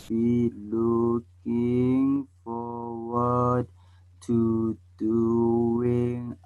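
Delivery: extremely slow, every word stretched out; draggingly slow, low monotone demonstration; speaking too slow. Shown as a demonstration. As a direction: drag each word out until it bores. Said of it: "So I just spoke slow".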